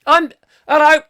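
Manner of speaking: high, loud mock caller voice; comically loud, high-pitched funny old bloke; Kevin Hall. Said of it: "Kevin could do that".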